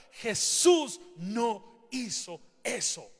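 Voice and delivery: normal talking voice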